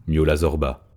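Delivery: plain reading voice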